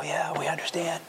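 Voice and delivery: hushed soft whisper of sympathy; imitating the pastor's whisper. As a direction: whisper like a gentle pastor consoling. Pastor answering kids' confessions.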